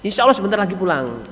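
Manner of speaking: soft, gracious welcome; acted courteous wife, hushed and pleasant; polite wife. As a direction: gently offer a seat while excusing yourself. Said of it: "Bahasanya sopan, enak, Saudara".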